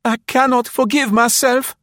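high, loud, anguished self-reproach; agonized remorse. Acted. Marie feigns guilt before the officers, in agony. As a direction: wail it in theatrical remorse. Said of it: "in an agony of remorse".

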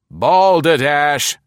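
slow, high, loud good-natured scoff. A narrator's reading. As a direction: scoff it off jovially. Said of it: "said Caspier good-naturedly".

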